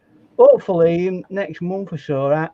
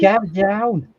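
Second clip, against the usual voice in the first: put-on Liverpool accent; 2 semitones higher than usual. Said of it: "at my rubbish Liverpool accent".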